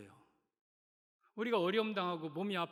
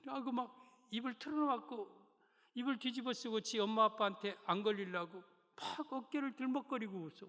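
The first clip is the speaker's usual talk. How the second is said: normal talking voice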